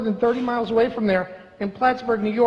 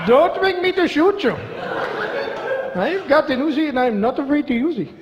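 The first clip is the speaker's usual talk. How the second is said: mock Indian bank robber, pitched up; Indian accent; 4 semitones higher than usual. Comedian voicing an Indian bank robber, jokey Indian accent. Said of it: "if they came from India".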